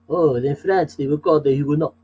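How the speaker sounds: quick French-accented delivery; playful French accent on "Huguenots"; mock French accent. Shown as a demonstration. Runs through national accents, now France.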